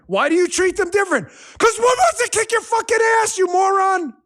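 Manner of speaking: high, loud yelling; furious shout. Real, not acted. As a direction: blow up at the critics. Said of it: "Sorry for the cursing".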